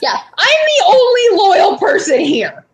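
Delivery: cracking up while claiming loyalty; laughing. Real, not acted. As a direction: laugh through the line. Joking loyalty boast.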